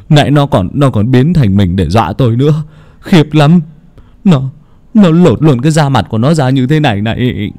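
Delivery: stammering, shaken man's voice; a touch of panic, tripping over words; husband tells his wife he saw the ghost, stammering; trembling with fright. A narrator's reading. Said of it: "cất giọng run rẩy vì cơn khủng hoảng".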